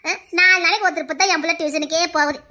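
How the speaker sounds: low, doting sing-coax; coaxing mother. Acted. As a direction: sweet-talk a little child. Mother coaxes child to tuition.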